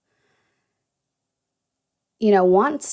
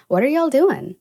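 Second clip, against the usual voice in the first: country y'all; 7 semitones higher, 4 dB louder than usual. Shown as a demonstration. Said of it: "I don't have to say it like country".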